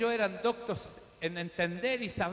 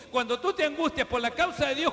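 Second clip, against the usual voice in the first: ordinary talk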